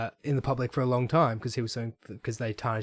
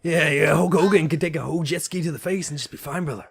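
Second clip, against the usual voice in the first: Hulk Hogan voice; 4 semitones higher, 4 dB louder than usual. Retold or imitated. Imitating Hulk Hogan catchphrase.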